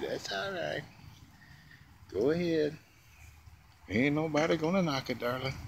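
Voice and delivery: light imitation of a crooning singer, pitch lifted; a hint of a smooth old rock-and-roll crooner. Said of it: "Like [name] said in my ding-a-ling song".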